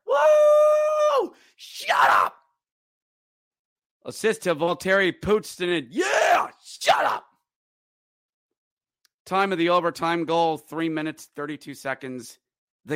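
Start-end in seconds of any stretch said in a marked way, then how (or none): 0.1-7.2 high, shrieking mimic of cheering fans; mock the rival fans' yelling. Announcer imitating gloating rival fans, mock shouting.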